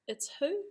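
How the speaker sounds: "It's who?" pitched high with a rising tune; puzzled question. Shown as a demonstration. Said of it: "give you a full example".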